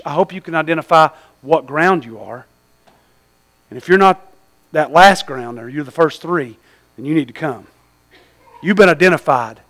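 ordinary talk

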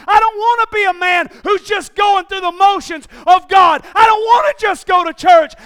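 loud, high, slow shouted sermon; rally the men, shouting them to God; preaching at full force. Acted.

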